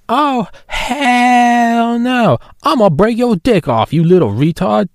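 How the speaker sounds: very high, hugely drawn-out cartoon outrage; South Park voice. Muse threatening Classy, slow high cartoon voice. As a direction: stretch the oh hell no out slowly, way up high. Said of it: "God, I love South Park".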